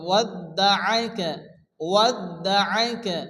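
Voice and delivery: ওয়াদ্দাআকা slowly, with a careful Arabic ayn; slow tajweed model of ওয়াদ্দাআকা, each syllable measured; clearly articulated ayn. Shown as a demonstration. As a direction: bring out the throat consonant ayn in ওয়াদ্দাআকা. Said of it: "আইনকে ভালো করে উচ্চারণ করে পড়তে হবে".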